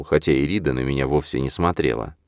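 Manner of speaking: ordinary delivery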